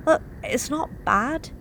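ordinary delivery, nothing marked

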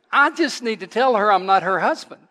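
chuckling through the line; half-laughing as he recalls it; laughter breaking into the words. Retold or imitated. Retelling a funny mistaken identity.

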